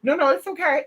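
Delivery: retelling refusing the candle in a high squeal; frantic high refusal. Retold or imitated. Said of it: "I was like".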